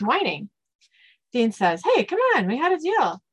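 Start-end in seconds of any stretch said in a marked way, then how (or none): 1.8-3.2 high-pitched mild objection; pleading protest. Acted. Dean holding the Yorkie to a deal, protesting.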